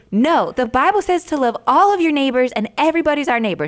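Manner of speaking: high, loud imitation of an indignant child; insistent little girl. Mom imitating her six-year-old daughter correcting another kid, insistent. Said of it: "And she was like".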